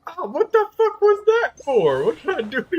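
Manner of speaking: hammed-up hurt guard; pained yelp. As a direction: yelp like a kicked enemy, outraged. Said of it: "he's just like".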